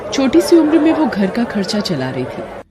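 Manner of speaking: ordinary delivery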